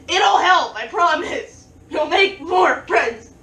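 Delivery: over-eager yelled reassurance; insistent promise. Pretend. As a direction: shout the promise to win them over. Loud mock pleading with viewers.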